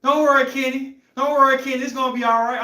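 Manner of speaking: high, loud encouraging mom voice; mother shouting encouragement from behind; mama cheering him on. Retold or imitated. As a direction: call out to your struggling son like a proud mama. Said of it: "I see a little lady going".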